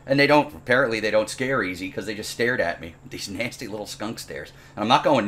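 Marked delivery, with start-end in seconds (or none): none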